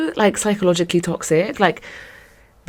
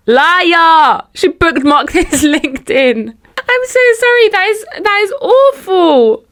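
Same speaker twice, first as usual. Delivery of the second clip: much higher and louder, shocked; outraged shout; 11 semitones higher, 5 dB louder, slower than usual. Real, not acted. Listener shouting at the story's twist. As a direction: cry out in disbelief.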